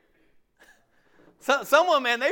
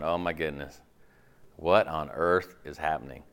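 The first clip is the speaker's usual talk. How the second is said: shocked listener; 13 semitones lower than usual. Retold or imitated. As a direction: lower voice, mock alarm. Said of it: "You're going".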